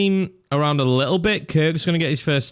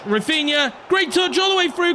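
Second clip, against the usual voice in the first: much higher, thrilled commentary call; excited; 11 semitones higher than usual. Real, not acted. Commentator hyping his winger's touch, much higher and excited. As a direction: ride the build toward the goal. Repeated call rising to goal.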